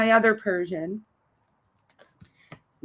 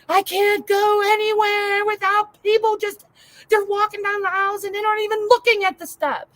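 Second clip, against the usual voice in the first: much higher, put-on complaining voice; hammed-up frustrated rant, pitched way up; mock rant; 11 semitones higher than usual. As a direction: whine about shoppers blocking the aisles. Own rant retold.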